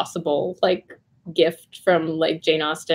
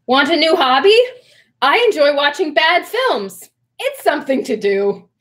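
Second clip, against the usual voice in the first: loud, high recitation while bouncing on one foot; hopping on one foot; 8 semitones higher, 5 dB louder than usual. Pretend. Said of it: "Hop up and down on one foot".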